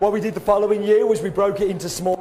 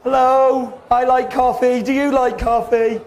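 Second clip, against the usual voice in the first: mock presenter voice; 5 semitones higher, slower than usual. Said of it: "kinda going".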